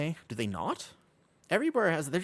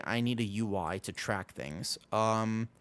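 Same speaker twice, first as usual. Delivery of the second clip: ordinary talk, nothing marked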